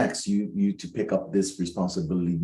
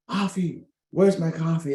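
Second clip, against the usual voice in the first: boss calling across hall; 8 semitones higher, 3 dB louder than usual. Retold or imitated. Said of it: "I hear him from across the hall".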